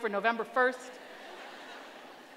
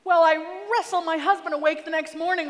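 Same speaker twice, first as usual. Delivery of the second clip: normal talking voice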